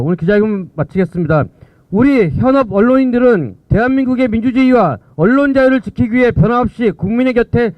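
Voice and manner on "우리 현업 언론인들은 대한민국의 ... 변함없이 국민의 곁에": raised, rousing resolution read; roaring rallying speech. Acted. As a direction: lift the voice to rally the crowd. (결의를 다지듯 힘차게)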